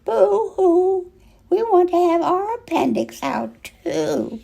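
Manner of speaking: put-on high whiny little-girl boo-hoo; mock crying girls. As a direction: wail like jealous little girls. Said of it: "all the little girls cried".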